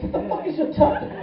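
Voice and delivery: high, breathy-free mock woman's squeak, half laughing; mock girl voice. Woman's reply acted high.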